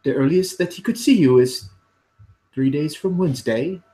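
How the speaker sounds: lightly voiced put-out clerk. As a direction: state it like an offended clerk. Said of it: "and he says it as such".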